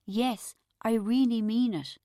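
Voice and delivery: slow, kind reassurance; nicely. A narrator's reading. Narrator reassuring Bridget, slow and kind. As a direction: say it gently and slowly. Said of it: "I said nicely".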